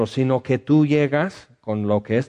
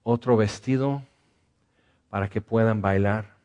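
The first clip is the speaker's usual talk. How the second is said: earnest pastoral prayer. Acted. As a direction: pray gently over the sad. Leads prayer for the sad.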